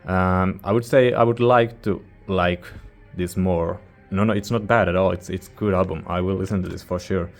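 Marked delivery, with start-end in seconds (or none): none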